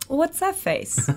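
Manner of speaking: lightly voiced challenging question; a slow, mock-teasing challenge; Leo in character. Leo improvising at her in scene, slow teasing question. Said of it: "in the scene, he goes".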